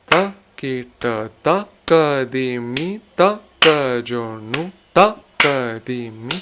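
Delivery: accents pushed onto off-beat syllables like ta and nu; shifted emphasis. Shown as a demonstration. Teacher showing shifted accents that break the expected beat. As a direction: punch the syllables against the count. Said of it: "the parts where I'm emphasizing the syllables are different".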